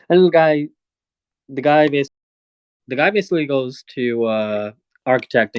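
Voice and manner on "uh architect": ordinary delivery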